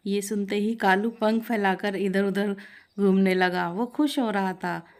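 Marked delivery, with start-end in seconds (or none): none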